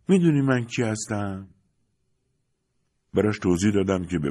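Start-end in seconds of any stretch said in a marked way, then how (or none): 0.1-1.5 a sly, bitter question with a touch of a smirk; mild bitter sneer from an old woman. A narrator's reading. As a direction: let some poison into the smile. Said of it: "با زهرخندی گفت".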